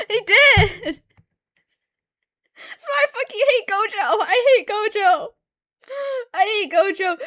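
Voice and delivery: high, loud, laughing indignation; laughing rant. Real, not acted. Complaining to viewers about Gojo, high laughing rant. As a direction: vent with a laugh.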